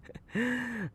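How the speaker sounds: high manic laugh; high manic giggle. Acted. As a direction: giggle hungrily. Hungry gleeful laugh.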